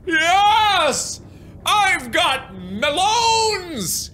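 high drawn-out cheer; victory cry. Real, not acted.

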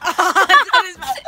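laughing through the words; cracking up while saying it. Real, not acted. Cream lands on his mouth.

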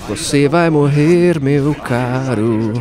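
drawn-out, very slow threat; slow mock menace. Gamer taunting the boss, very slow mock menace. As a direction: stretch every word like a villain.

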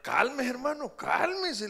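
loud, high pleading to calm down; urgent shout. Retold or imitated. (gritando alto, ¡cálmese!)

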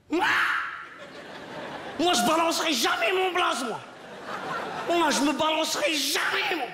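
a schoolboy defying the teacher who asks his name; defiant kid. Retold or imitated. Kid defiantly refusing to give name.

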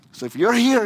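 ordinary talk

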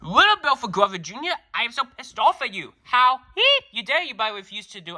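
a dad venting his anger at his son; angry father scolding. Acted.

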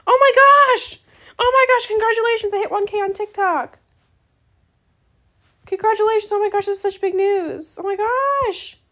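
high, loud excited squeal; squealing excitement. Real, not acted. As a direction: shriek with delight at big news. Friend hit 1K, celebrating.

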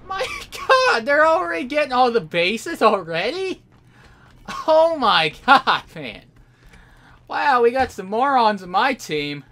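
high, loud squealing rant; exasperated outrage. Real, not acted. Player watching his team lose bases, exasperated outrage. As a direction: yell it in real disbelief, voice shooting up. Rages as bases are lost.

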